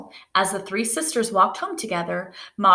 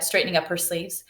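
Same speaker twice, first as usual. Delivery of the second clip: ordinary delivery, nothing marked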